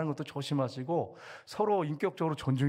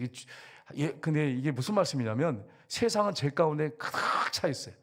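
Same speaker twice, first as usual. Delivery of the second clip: normal talking voice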